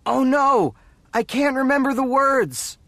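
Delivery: high, loud cry of dismay; panicked. Acted. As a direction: blurt it out in rising panic. Panics on stage, forgets words.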